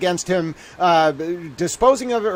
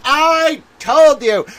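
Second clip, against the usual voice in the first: ordinary talk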